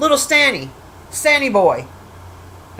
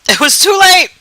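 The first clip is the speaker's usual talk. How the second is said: "It was too late!" shouted higher and louder, stress on "late"; loud exclamation; 9 semitones higher, 7 dB louder than usual. Real, not acted. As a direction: blurt it out in real dismay. Repeats the phrase, then exclaims.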